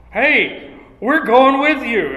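the disciples jump in, all in; emphatic. Acted. Disciples eagerly tell Peter they're coming, loud and emphatic.

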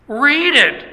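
teacher commanding the class to read; sharp order. Retold or imitated. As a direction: snap it at them.

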